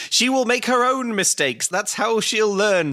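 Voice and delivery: high mock Italian accent, slow at the end; wise Italian dad. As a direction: dispense wisdom like an Italian papa. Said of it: "says Dad wisely and Italianly".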